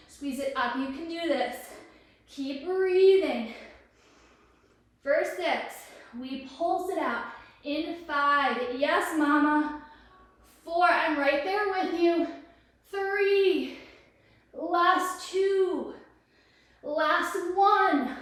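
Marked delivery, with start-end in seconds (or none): 2.3-3.5 stretched-out, louder reminder to breathe; slow, firm breathing cue; slow coaching cue. Shown as a demonstration. Encouraging through the hard set. 8.8-18.1 louder, higher count-down; cheering count. Real, not acted. Cheering through the final counts.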